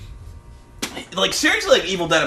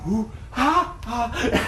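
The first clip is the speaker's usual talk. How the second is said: straining grunt; 4 semitones higher, 5 dB quieter, much slower than usual. Retold or imitated. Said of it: "he's like".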